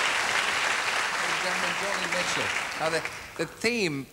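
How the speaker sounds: slow, high showman's announcement; announcer voice. Real, not acted. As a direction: draw it out like a stage host. Host introduces guest on stage.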